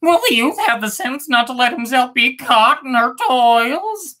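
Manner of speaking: high bird voice; other bird worried. Acted. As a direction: fret like a small bird.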